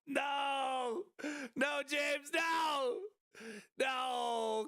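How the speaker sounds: high shouted no, pleading; anguished denial. Real, not acted. Begging chat viewer James.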